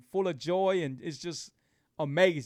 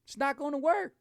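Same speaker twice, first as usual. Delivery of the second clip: raised high, the warning repeated in a mock voice; 9 semitones higher than usual. Shown as a demonstration. Host warning viewers about cheating on keto, in a high mock voice. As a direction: pitch it up, playful warning. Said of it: "I tell people".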